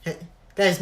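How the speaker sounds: usual speaking voice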